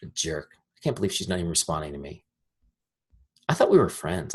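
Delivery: low, soft, hurt grumble; under-the-breath mock resentment; sulky inner thought. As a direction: think it to yourself, offended. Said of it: "this is what I think".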